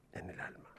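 breathy whispered ending. A narrator's reading. Father whispers the lesson to his son, whisper. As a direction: breathe the last words like a secret.

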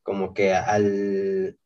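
ordinary talk, nothing marked

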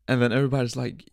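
ordinary talk, nothing marked

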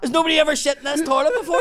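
loud, high, fast rant; imitating his outraged shouting; indignant yell. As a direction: yell it out as a rapid complaint. Man keeps yelling from toilet.

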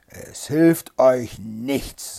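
very slow, loud, weighty villain; merciless Bluebeard. A narrator's reading. Bluebeard refuses his weeping wife, merciless. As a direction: stretch out every word, unrelenting.

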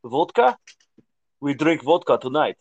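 mock Russian accent. Shown as a demonstration. Arguing cohost shows what Russian sounds like, in a Russian accent. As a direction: put on a stock Russian accent. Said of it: "Have you heard a Russian accent".

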